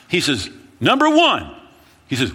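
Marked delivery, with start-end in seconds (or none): 0.8-1.5 high, loud counting off; loud retort. Retold or imitated. The friend answering the panicked pastor, a loud retort. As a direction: shout back the first reason. Friend calmly lists reasons, retold loudly.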